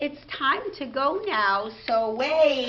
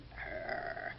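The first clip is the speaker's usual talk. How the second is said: purring; 16 dB quieter than usual. Pretend. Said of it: "Purr like a kitten".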